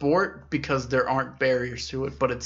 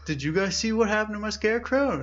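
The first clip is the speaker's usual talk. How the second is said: higher, lightly voiced old man; old man asking; 8 semitones higher, faster than usual. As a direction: ask the lazy brothers like a worried old man. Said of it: "the old guy's like".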